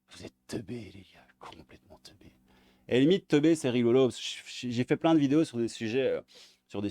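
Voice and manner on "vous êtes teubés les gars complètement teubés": whisper. Real, not acted. Exasperated aside to viewers.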